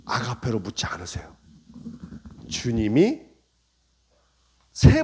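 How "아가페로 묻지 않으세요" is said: hushed, breathy secret aside; whisper. Real, not acted. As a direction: drop to an unvoiced whisper. 설교 중 속삭임. (속삭이듯 말하기)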